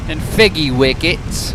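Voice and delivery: slow, lightly put-on British accent; a hint of a toff saying figgy wickets; mock British accent. Slow, mock British accent naming made-up British food. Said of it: "How is my British accent".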